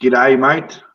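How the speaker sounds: light Australian greeting; Australian accent, cheerful greeting; Australian g'day. Shown as a demonstration. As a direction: say it like an Aussie, friendly. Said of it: "have you heard of g'day".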